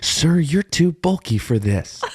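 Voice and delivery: high, slow mock attendant; a high exasperated attendant scolding; exasperated staff. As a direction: plead with him, drawn out and high.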